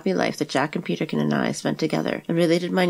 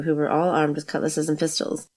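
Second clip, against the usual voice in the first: ordinary delivery, nothing marked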